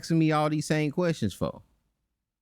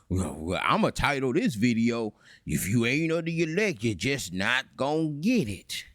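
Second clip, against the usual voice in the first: mocking imitation; 5 semitones lower than usual. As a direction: drag it out, mimicking his self-important titling. Mockingly acts out opponent titling a video.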